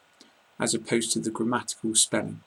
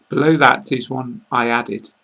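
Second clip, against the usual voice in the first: ordinary delivery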